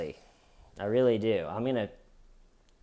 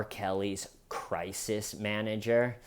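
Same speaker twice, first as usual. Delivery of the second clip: ordinary talk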